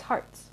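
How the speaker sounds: "Hearts" said softly as HARTS; "Hearts" with an ar vowel, not er; shortened local name. Shown as a demonstration. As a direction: say "Hearts" like the organ, hushed. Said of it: "if you shorten it".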